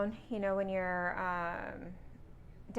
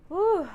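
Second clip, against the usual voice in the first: loud, high whew of a calming exhale; breathing out; 10 semitones higher, 9 dB louder than usual. Shown as a demonstration. Trainee driver shows her calming breath, big whew. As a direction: blow the breath out hard to settle yourself. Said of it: "I use my breathing techniques".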